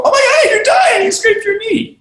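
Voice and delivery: hammed-up hysterical parent; panicked parent. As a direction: shriek it like an overreacting parent. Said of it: "The parent doesn't go".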